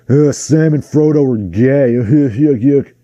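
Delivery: sneering imitation of a homophobe; lightly voiced jeer at Sam and Frodo; mocking voice. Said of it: "yet another permutation of the stupid, like".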